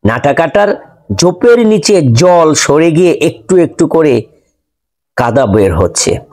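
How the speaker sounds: usual speaking voice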